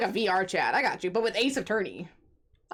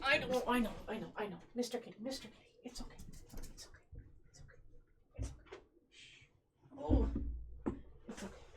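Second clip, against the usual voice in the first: soft, hushed soothing to a pet; calm the scared cat down gently; coaxing the cat to sleep, soft and soothing; 15 dB quieter than usual. Real, not acted.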